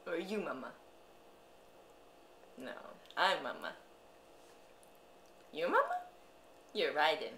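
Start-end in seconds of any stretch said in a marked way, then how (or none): 5.5-6.0 high playful question. Real, not acted.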